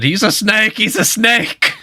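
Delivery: squeaky high mock of the shout; high mocking imitation. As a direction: parrot the panic in falsetto. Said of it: "You said".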